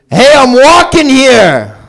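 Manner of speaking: high, slow, loud New York "Hey" yell; New Yorker impression. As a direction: belt it like a cabbie leaning out the window. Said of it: "this is my impression of a New Yorker".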